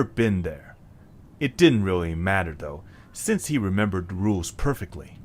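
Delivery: story narration. A narrator's reading. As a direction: tell the next part of the tale. Narration of the journey.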